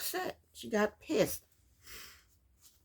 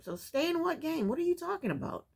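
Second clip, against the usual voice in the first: incredulous mock question; 9 semitones higher, 3 dB quieter than usual. Real, not acted. Vlogger mocking contestant.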